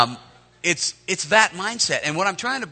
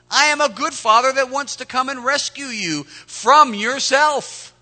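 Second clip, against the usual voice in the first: put-on parent to teenager; 6 semitones higher, 5 dB louder than usual. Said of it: "Sound like a parent talking to a teenager".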